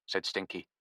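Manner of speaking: usual speaking voice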